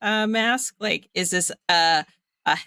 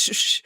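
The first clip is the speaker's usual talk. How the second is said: shushing. Acted. Bailey hushes her upset friend, shushing. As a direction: hush someone gently. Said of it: "she just goes".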